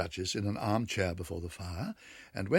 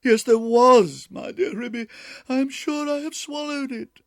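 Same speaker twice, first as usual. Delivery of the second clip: distressed wail; 11 dB louder than usual. Acted. Duchess insisting to Ribby she swallowed it, wailing. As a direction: insist through tears.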